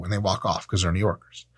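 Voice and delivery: ordinary talk, nothing marked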